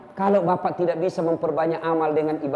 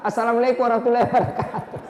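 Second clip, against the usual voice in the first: Assalamualaikum warahmatullahi wabarakatuh rattled off fast and slurred with a laugh; rushed salam; 2 semitones higher, faster than usual. Retold or imitated. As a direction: gabble the salam through a chuckle. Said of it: "Apalagi itu aswabar". (salam diucapkan cepat sambil tertawa)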